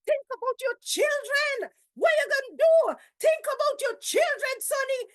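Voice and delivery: high, loud pleading yell at the neighbour; retold shouting. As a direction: call out to stop a fight. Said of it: "she shouting".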